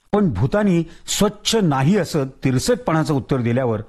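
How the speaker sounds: usual speaking voice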